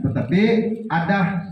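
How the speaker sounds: ordinary delivery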